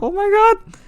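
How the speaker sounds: high-pitched squeal of disbelief; internal scream. Retold or imitated. Telling viewers about the text reply, high excited squeal. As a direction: squeal it like you can't believe your luck. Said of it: "I was screaming internally".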